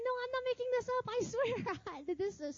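high, rushed insistence; hammed-up high-pitched pleading; retold protest. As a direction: protest your innocence in a quick, high voice. Retold protest to doubting preacher.

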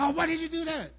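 high-pitched mock exasperation; hammed-up indignant protest; angry. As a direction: burst out in an acted complaint. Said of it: "I'll get angry and be like".

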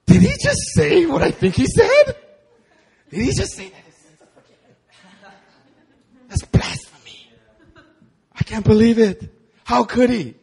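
high, scandalized squawk, breaking into a whisper in the middle; mock outraged Pharisees. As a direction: gasp at blasphemy in shocked disbelief. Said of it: "the Pharisees got so angry".

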